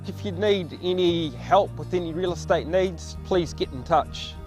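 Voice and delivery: ordinary delivery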